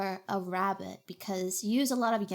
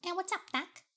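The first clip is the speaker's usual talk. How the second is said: higher, quieter cartoon rabbit voice; drawling cartoon rabbit voice; Bugs Bunny; 7 semitones higher, 7 dB quieter than usual. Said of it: "I can do, like".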